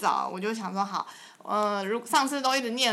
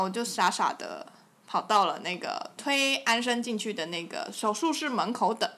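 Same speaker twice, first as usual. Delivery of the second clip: normal talking voice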